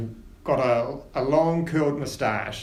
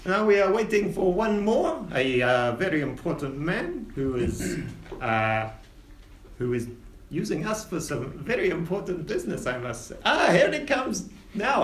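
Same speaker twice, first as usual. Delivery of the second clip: higher, pompous captain; captain Winters; 6 semitones higher than usual. Acted. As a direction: hold court with the passengers. Captain chats with Oren.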